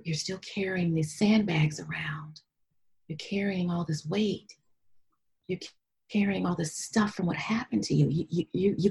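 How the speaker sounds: soft, half-whispered reckoning; hushed self-talk. Retold or imitated. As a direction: confront yourself quietly, retelling it. Retelling hushed talk to herself.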